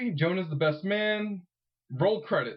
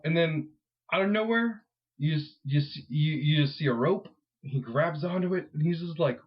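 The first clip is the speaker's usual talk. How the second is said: ordinary talk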